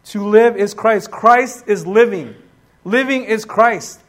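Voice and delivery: ordinary talk, nothing marked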